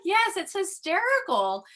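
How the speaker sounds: high-pitched retelling of helpless amusement; retold laughter. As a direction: answer as if tickled, pitch jumping up. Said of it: "I start laughing".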